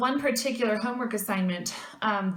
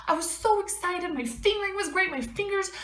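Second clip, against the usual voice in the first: much higher, pouring it all out to mom; upset excited rant; 9 semitones higher than usual. Retold or imitated. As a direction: gush it in a rush, hurt and proud. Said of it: "I called my mother and I said".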